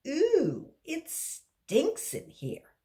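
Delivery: high, slow whiny disgust; disgusted girl. Acted. Marisha complaining to the class about the smell, slow whiny disgust. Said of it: "said Marisha".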